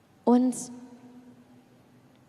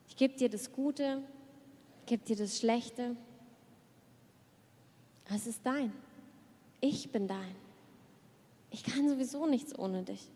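soft surrender prayer; 7 dB quieter than usual. Acted. Preacher voices an intimate prayer to the Lord, softly surrendering. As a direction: give everything over quietly, almost to yourself.

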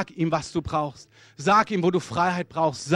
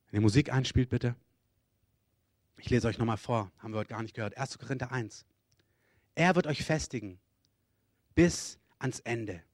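ordinary delivery

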